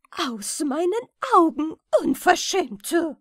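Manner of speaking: loud, high furious banishing shout; angry cry. A narrator's reading. Queen banishes the rat, shouting furiously. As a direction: throw her out with rage. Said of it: "rief die Königin zornig".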